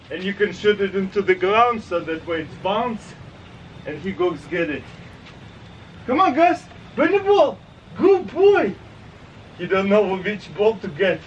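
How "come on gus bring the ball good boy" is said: very high, singsong pet talk; excited high-pitched dog voice; calling the dog. Real, not acted. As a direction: egg the dog on and praise it up high. Calling and praising his dog.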